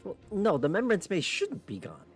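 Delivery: ordinary talk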